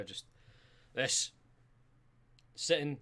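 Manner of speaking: ordinary talk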